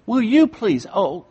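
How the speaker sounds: high, loud preaching cry; loud pleading shout; shouting plea. Real, not acted. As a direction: plead at full force, voice up high. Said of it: "calm down, Pastor".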